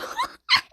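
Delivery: high piercing laugh burst; high squealing laugh. Real, not acted. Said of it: "why do I always laugh like that".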